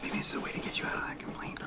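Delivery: quick hushed whisper behind her back; fast conspiratorial whisper. Acted. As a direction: whisper the scheme so she can't hear it. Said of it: "We're whispering".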